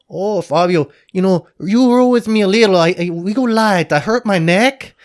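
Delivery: put-on loud, high-pitched Brazilian coach accent; boisterous Brazilian accent, far above your own voice. Brazilian coach asking his student to go light, loud Brazilian accent. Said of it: "your typical Brazilian guy".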